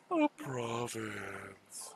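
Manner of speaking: Oh, province stretched out very slowly; drawn-out province. Shown as a demonstration. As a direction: draw out each sound of "province" slowly and savouringly. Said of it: "I have to say".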